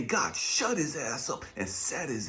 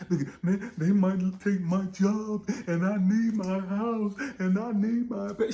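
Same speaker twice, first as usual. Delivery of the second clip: scared worker; 6 semitones higher, 6 dB louder than usual. Retold or imitated. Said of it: "We're scared of these motherfuckers".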